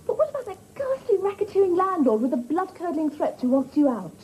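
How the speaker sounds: normal talking voice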